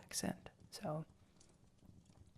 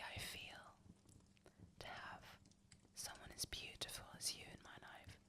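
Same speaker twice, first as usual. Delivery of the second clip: very soft, close-to-the-ear whisper; 16 dB quieter than usual. Acted. Boyfriend whispering sweet words into her ear. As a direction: whisper right into their ear. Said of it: "and I will whisper".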